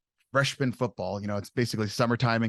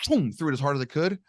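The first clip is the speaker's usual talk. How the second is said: usual speaking voice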